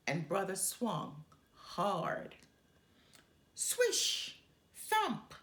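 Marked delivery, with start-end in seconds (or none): 3.5-5.3 high, whispered swoosh then a sharp thump; swish and thump noises. Acted. Narrator acting out the strike for listeners, sound effects. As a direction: mimic the bat swish and ball thump.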